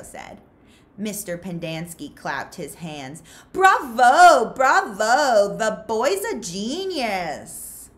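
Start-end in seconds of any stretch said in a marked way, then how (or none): 3.5-7.9 mock cheer. Counselor mockingly praises Zero.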